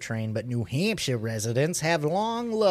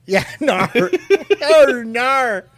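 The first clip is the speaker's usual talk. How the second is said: high, loud hammed-up Kiwi accent; New Zealand accent; 10 semitones higher, 10 dB louder, much slower than usual. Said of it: "nobody can do a New Zealand accent".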